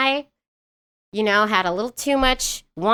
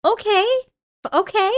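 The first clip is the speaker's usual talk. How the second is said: high-pitched, uncertain okay; hesitant going-along; 11 semitones higher than usual. Retold or imitated. Mother retelling her reply to her small son, high-pitched and hesitant. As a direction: play a thrown parent humoring a kid.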